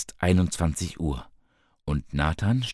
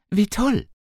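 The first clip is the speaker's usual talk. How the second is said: thrilled squeal, way up high; delighted, soaring high exclamation; enthusiastic; 3 dB louder than usual. A narrator's reading. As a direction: gush it like great news just landed. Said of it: "sagte Bianca begeistert".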